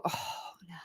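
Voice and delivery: heartbroken whisper, all breath; softly whispered heartbreak. Real, not acted. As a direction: whisper it as if it hurts. Moved by the police dogs' last moments.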